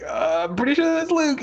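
the fan starts to realize it's Luke; rising excitement. Retold or imitated. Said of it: "and you're like".

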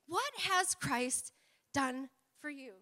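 What has Christ drawn out slowly; slow emphatic question. Shown as a demonstration. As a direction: lean on each word of the question. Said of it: "think about some homework".

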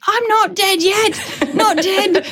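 put-on high, slow, giggly child's squeak; son imitating Python. Son quoting Monty Python at home, high and giggly. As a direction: drag it out high while laughing. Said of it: "constantly he's like".

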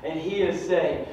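ordinary delivery

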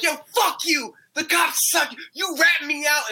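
high-pitched mimicked tirade; cussing out. Imitating the dealer in the parking lot, angrily cussing. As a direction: rant in a high voice, acting out outrage. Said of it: "cussing them out".

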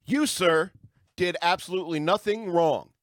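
ordinary talk, nothing marked

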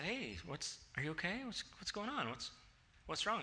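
gentle concern. Retold or imitated. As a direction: coax her gently. Husband gently asking wife.

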